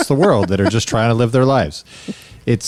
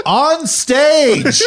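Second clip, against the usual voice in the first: high, laughing delivery; mock comeback; 13 semitones higher than usual. Heckling the comic with a high laughing comeback. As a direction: fire back the answer. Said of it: "And I go".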